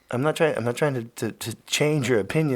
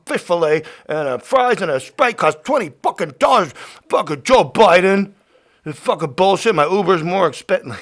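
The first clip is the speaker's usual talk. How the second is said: loud, higher, hammed-up furious rant; mock angry rant; 4 semitones higher, 9 dB louder than usual. Host pretending his podcast turned into an angry rant, shouting. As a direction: play at being furious, shouting. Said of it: "What if I just turn this into a super angry podcast".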